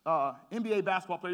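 usual speaking voice

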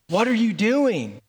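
a son imagining his dad shouting at him; mock yelled texts. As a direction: read the texts as if dad were yelling. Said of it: "like, yelling at you".

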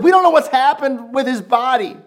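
woman running to the disciples in alarm; distraught report. Retold or imitated. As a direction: blurt out the bad news. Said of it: "she's going like".

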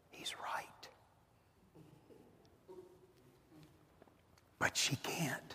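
breathy confiding whisper. Acted. Preacher voicing the wife's hidden thought in a whisper. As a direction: drop to a secret whisper.

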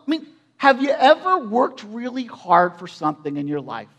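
ordinary delivery

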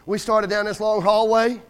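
ordinary talk, nothing marked

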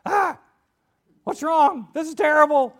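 high, loud cry of alarm; hammed-up full panic; panicked. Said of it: "left there to panic".

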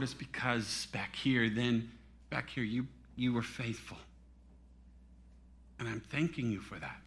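low, hushed, gentle voice; quiet, intimate divine reply; God speaking tenderly. Retold or imitated. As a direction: softly thank a faithful servant. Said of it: "he said to me".

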